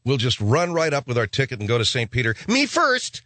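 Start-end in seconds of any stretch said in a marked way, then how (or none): 2.5-3.2 high eager squeak; pushy sinner. Acted. Sinner at the pearly gates demands to go first, high. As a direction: shove to the front, high. Rushing to Saint Peter.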